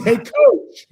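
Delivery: a big, high shout of greeting; excited player. Retold or imitated. Basketball player hails his coach with a high excited shout. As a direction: call out to the coach, thrilled.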